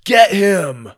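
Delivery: high, loud urgent yell; shouted game call; crying out. A narrator's reading. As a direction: yell to a teammate to give chase. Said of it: "Dallas cried".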